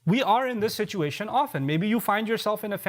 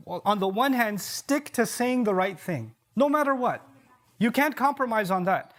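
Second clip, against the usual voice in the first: ordinary delivery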